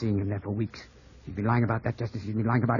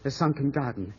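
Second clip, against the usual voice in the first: usual speaking voice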